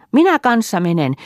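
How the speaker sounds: put-on high, loud child chiming in; eager. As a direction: jump in eagerly as a little girl. Sanna eagerly joins in. (innokkaasti, korkealla äänellä)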